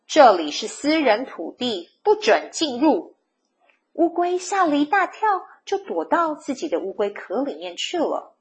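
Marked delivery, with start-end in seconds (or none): none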